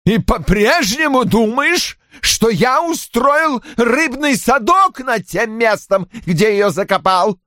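put-on a father throwing old suspicion back at her; bitter accusation. Roy keeps accusing his daughter. (медленно, язвительно, с обидой)

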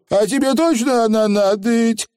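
высокий робкий голосок; спроси с опаской, повыше; осторожно спрашивает. A narrator's reading. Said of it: "осторожно спросил Явор". (осторожный вопрос)

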